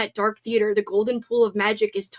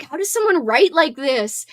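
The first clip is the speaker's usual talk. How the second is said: awed; 4 semitones higher, 5 dB louder than usual. Retold or imitated. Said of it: "I just think, like".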